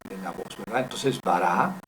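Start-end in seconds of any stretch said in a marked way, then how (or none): 1.2-1.8 "Bara" with a long, rising final "ra"; stress on last syllable. Shown as a demonstration. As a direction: lean on the second syllable of "Bara". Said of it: "ahí pongo el acento de la voz".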